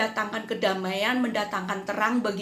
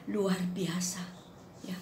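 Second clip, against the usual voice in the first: whispered in awe; awed whisper; 8 dB quieter than usual. Real, not acted. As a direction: breathe the words in wonder. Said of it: "awed whisper".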